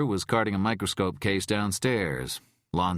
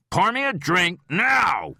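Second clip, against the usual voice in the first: loud, slow, higher snap; snapped; 14 semitones higher, 7 dB louder than usual. A narrator's reading. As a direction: snap it out as a demand. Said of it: "Rhyme snapped".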